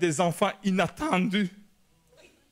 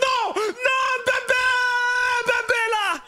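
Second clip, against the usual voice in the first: high, loud frantic shouting; frantic high cry down the phone; panicked yell; 3 dB louder, slower than usual. Retold or imitated. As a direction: shout that the baby is here.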